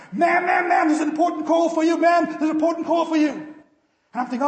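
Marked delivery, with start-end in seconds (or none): 0.1-3.3 high, loud excited shout. Retold or imitated. Schoolboy bursting into the classroom, shouting the news. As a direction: yell it at the top of your lungs. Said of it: "he shouts".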